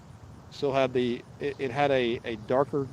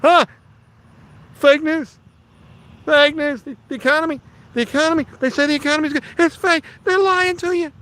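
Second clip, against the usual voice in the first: mock politician rant; 13 semitones higher, 9 dB louder than usual. Mock politician after debate talk.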